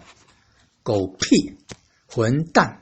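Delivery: "狗屁" slow and low, "混蛋" short and falling; abrupt fourth tone. Shown as a demonstration. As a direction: end each curse with a sharp falling fourth tone. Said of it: "So you use fourth tone".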